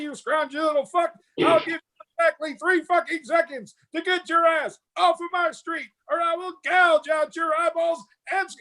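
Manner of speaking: high-pitched, full-force drill sergeant tirade; high sustained parade-ground yell; Full Metal Jacket drill sergeant. Retold or imitated. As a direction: scream insults like a drill instructor chewing out recruits. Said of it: "the drill sergeant from Full Metal Jacket".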